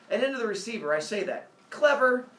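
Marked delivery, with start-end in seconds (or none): 1.7-2.3 loud, high, self-satisfied "Clever"; smug greeting. Acted. Husband answering the phone, smugly loud. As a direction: bark "Clever" into the phone as if it's witty. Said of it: "he answers the phone with".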